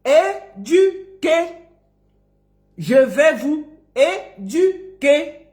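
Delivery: very slow "é-du-quer" for "éduquer"; long pauses between the syllables of "éduquer"; dictation. Shown as a demonstration. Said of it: "écrivez tous".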